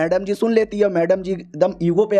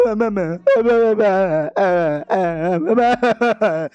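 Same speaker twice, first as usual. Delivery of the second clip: hammed-up sobbing; 2 semitones higher, much slower than usual. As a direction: wail each syllable like an overgrown baby. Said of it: "और रोने लग जाता है पापा".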